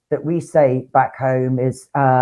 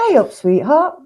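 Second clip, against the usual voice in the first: high sing-song Nottingham accent; Nottingham greeting; 7 semitones higher than usual. Shown as a demonstration. Showing co-host how Nottingham folk greet, high Nottingham lilt. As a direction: chirp it up high like a Nottingham local. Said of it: "sweetheart type of thing".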